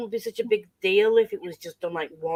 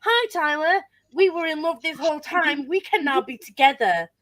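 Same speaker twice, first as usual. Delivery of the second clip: mock romantic character; 8 semitones higher than usual. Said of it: "be like".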